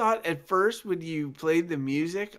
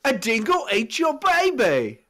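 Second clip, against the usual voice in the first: high, loud hammed-up Australian accent; 10 semitones higher, 6 dB louder than usual. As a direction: belt it out in a cartoon Aussie voice. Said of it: "I tried to do Australian".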